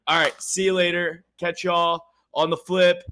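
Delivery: ordinary talk, nothing marked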